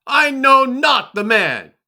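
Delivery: loud, high, desperate shouted oath; cursing denial. Acted. Kepha denies Yahushua to the bystanders, shouting and swearing. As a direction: swear it at the top of your voice. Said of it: "Then began he to curse and to swear".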